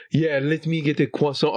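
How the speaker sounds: lightly put-on French accent; playful hint of French accent; mock French accent. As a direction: imitate someone faking a French accent. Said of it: "he would pretend to do, like, a French accent".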